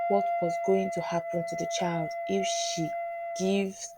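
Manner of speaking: ordinary delivery, nothing marked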